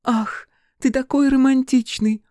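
high, slow swooning fairy; pixie. A narrator's reading. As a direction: sigh it like a lovestruck fairy. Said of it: "томный голос фейки".

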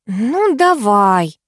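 very slow, drawn-out consent; reluctant-sounding, dragged agreement; agreeing. A narrator's reading. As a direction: stretch it out as you give in. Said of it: "согласилась Катя".

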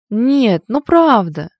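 loud, high, insistent pleading; persistent. A narrator's reading. Friend who will not let up, insistent. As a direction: keep pressing, refusing to drop it. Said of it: "не унималась она".

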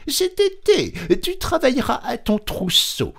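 very high squeaky mouse voice. A narrator's reading. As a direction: pinch the voice up into a small mouse. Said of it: "lui dit la souris". (voix de souris très aiguë)

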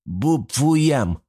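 put-on toddler babbling through a mouthful; nasal baby mumble, mouth full of food; child with mouth full. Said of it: "прогундосил малыш Том с полным ртом".

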